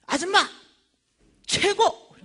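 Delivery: high, loud acclaim; imitated shouted praise. Tape seller finally praising preacher after three years, shouting. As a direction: holler praise from down the stairs. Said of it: "이렇게 시키셨더니 이런 거야".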